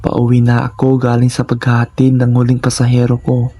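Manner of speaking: usual speaking voice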